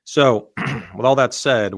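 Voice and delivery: ordinary delivery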